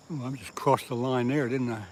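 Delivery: ordinary delivery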